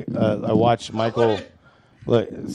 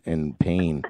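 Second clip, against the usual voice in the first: normal talking voice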